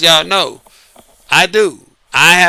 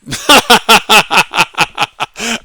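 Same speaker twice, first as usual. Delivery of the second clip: amused laugh; 2 semitones higher, 3 dB louder than usual. Real, not acted. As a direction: burst of laughter. Laughs at own punchline.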